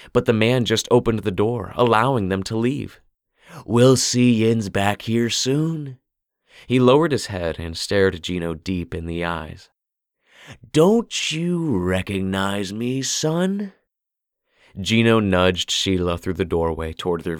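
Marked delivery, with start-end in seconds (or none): 3.7-6.0 put-on slow, drawn-out old man voice, higher; creepy shopkeeper calls a greeting; hoarse old man calling. A narrator's reading. 10.7-13.7 put-on slow, drawn-out old man voice, higher; creepy shopkeeper calls a greeting; hoarse old man calling. A narrator's reading.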